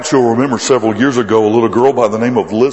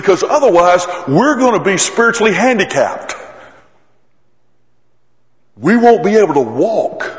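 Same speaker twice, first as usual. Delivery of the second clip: full-force preaching; 7 semitones higher than usual. Acted. Preacher to congregation, raising voice in fervent preaching. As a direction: push the point home from the pulpit.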